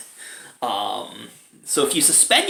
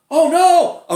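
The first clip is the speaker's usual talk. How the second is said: loud, high mock cry of dismay; mock alarm; 10 dB louder than usual. Film character reacting to a death, mock alarm. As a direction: exaggerate the shock, high and loud. Mimics reaction to people dying.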